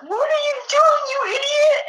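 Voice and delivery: high, shouted mock king yell; angry king. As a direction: yell at him like an outraged king. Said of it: "the king is like".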